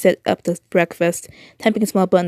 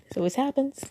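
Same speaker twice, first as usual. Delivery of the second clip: hushed despair; 6 semitones higher, 10 dB quieter than usual. Acted. America's inner voice, trauma.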